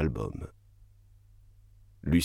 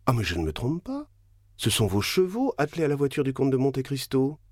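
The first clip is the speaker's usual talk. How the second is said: s'écrier plus aigu, découverte soudaine; exclamation; 7 semitones higher than usual. Acted. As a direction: annoncer depuis la fenêtre avec éclat. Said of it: "s'écria Debray". (Debray s'écrie à la fenêtre, voix plus aiguë)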